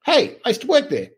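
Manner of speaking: high-pitched mock double take, oh hey; surprised. To himself watching the interview, a high surprised oh hey. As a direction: pitch way up in sudden recognition. Realizing he used to work there.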